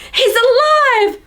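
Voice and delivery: high, drawn-out joyful squeal; hammed-up thrilled cry; excited inner voice. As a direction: act out overjoyed disbelief. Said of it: "someone's internal voice being like".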